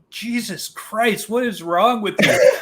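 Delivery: shocked and disgusted, drawn out; disgusted exclamation. Retold or imitated. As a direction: recoil in mock horror. Teammate reacting to smell.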